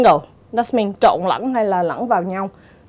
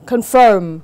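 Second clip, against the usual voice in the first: "confirm" said con-FIRM; second-syllable stress; 4 dB louder than usual. Shown as a demonstration. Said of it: "dấu nhấn sẽ rơi vào âm tiết thứ hai". (nhấn âm tiết thứ hai của "confirm")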